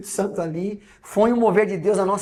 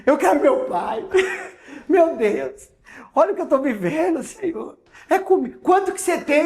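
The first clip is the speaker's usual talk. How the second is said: high, louder, half-laughing despair; panicky laughing; 9 semitones higher, 5 dB louder than usual. Retold or imitated. Wife panicking at the register, higher and louder with nervous laughter.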